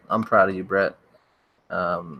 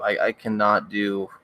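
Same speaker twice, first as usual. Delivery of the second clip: ordinary talk, nothing marked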